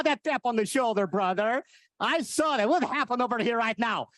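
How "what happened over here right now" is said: normal talking voice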